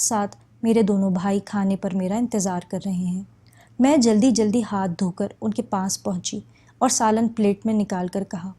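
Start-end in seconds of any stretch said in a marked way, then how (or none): none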